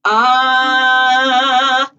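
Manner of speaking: an open ah sung through a square mouth, right at the front; a coach demonstrating the theater vowel. Shown as a demonstration. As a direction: sing it out through the teeth. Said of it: "I would say square mouth".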